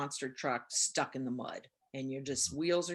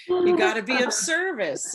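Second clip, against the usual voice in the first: much higher and louder, hammed-up lecturing; mock preachy voice; 12 semitones higher, 10 dB louder than usual. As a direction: parrot the meeting regulars with a teasing edge. Mimicking people at meetings.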